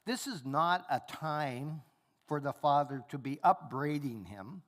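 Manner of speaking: normal talking voice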